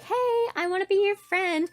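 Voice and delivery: high, gushing mock friendliness; hammed-up high-pitched hello; mock eager greeting. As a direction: act out an over-eager new friend. Acts instant best-friends meeting.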